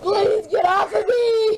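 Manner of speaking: crying plea. Real, not acted. Girl pinned down, pleading.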